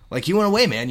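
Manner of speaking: high, loud, rising enthusiasm; upbeat welcome-back. Shown as a demonstration. Host clarifying his friendly meaning, upbeat delivery. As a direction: lift it up as happy news for a pal. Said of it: "You had an adventure and you came back".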